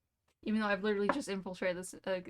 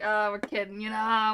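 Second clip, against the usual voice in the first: loud teasing; 6 dB louder than usual. Acted. Council teasing the kid.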